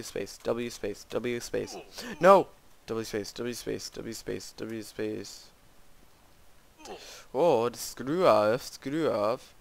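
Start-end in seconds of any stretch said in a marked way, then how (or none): 2.2-2.5 high, loud frustrated cry; frustrated shout. Real, not acted. Fails jump. 7.3-9.5 annoyed. Real, not acted. Annoyed at failing.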